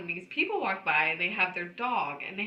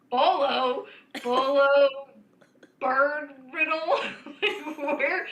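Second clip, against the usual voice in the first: baffled; 5 semitones higher, 6 dB louder, slower than usual. Retold or imitated. Baffled at husband's word.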